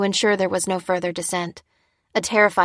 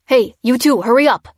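louder, higher barked command; barked order; 4 semitones higher, 8 dB louder than usual. A narrator's reading. Soldier barks at the couple to hurry. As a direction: snap orders at stragglers. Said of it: "barked a soldier".

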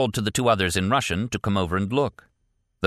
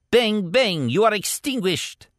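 a warlord pretending to shoot his captive; mocking warlord. A narrator's reading. Warlord mock-shoots Martin.

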